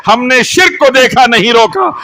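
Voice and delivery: high, loud, hammering sermon delivery; impassioned litany, shouted high; fiery preaching. Real, not acted. Preaches at full force.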